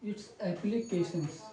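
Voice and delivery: very slow, soft, word by word; slow dictation. Real, not acted. Teacher writing the corrected heading, soft slow dictation. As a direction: murmur it slowly while writing it down.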